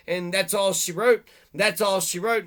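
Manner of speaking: usual speaking voice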